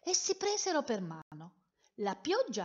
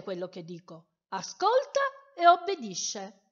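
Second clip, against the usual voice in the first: much higher, louder, lofty voice; haughty; 6 semitones higher, 8 dB louder than usual. Acted. Storyteller voicing proud purple boasting to the colours. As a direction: proclaim it like a pompous king. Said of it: "con grande superbia".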